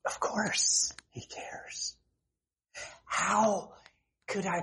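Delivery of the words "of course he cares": slow, soft breathy reassurance; tender whisper. Real, not acted. Preacher answers the congregation's fear, slow and tender. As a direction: hush it gently, full of comfort.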